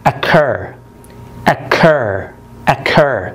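occur with CUR stressed; stress on last syllable. Shown as a demonstration. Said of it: "التشديد على المقطع الأخير".

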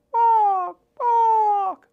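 very high, loud squawking bark; toucan call. Pretend. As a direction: squawk like a jungle bird.

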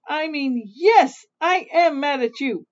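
high, loud fed-up outburst; yelling, angry. A narrator's reading. Fed-up Little Witch tells off mother witch, yelling. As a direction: build to a loud angry yell. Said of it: "She's yelling and screaming".

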